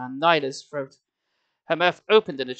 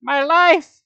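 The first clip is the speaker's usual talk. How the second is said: much higher, loud despairing shriek; 11 semitones higher, 10 dB louder, much slower than usual. A narrator's reading. Nyla shrieking over the broken pendant, despairing shriek. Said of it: "she shrieked".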